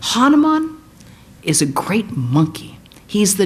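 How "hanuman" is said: higher and louder, proclaimed; exultant naming. Acted. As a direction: ring the name out with awe. Proclaims hero's name.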